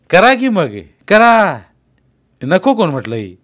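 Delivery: loud, high, exasperated retort; irritated shout. Acted. Landlord shouting back at a boasting worker, loud and annoyed. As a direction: fling it back at them, fed up.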